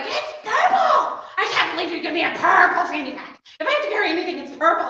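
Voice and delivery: loud, laughing mock outburst at mom; hammed-up furious yell, laughing through it; angry blow-up. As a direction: reenact the teenage meltdown, half laughing. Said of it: "And I was like".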